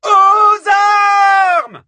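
high, drawn-out call to arms; yelling. A narrator's reading. Hippodamie calls the women to arms, yelling. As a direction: yell it like a battle signal. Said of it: "hurla Hippodamie".

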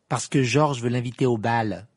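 loud, teasing taunt; mocking. A narrator's reading. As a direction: needle him with mock cheer. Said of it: "dit Fred d'un ton narquois".

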